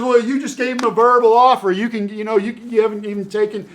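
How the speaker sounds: hammed-up parental protest; mock-upset parent. Parent scolding the son about his verbal offer, high mock scolding. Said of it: "And he goes".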